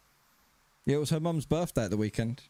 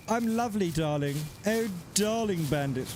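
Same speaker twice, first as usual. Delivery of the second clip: put-on posh English accent; 2 semitones higher than usual. Streamer greeting a viewer in a put-on posh English accent. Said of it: "I said that in an English accent".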